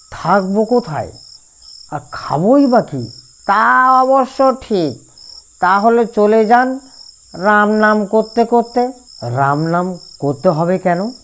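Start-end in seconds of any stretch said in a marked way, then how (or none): 3.5-8.9 much higher, slow nasal whine; nasal whiny voice. A narrator's reading. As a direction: drag it out high through the nose. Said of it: "তিলক খনখনি গলায় উত্তর দিল". (টেনে টেনে খনখনে নাকি সুরে)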